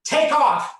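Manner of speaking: loud, urgent husband; urgent calling. Retold or imitated. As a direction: urge your partner to make a break for it. Husband urging her to flee.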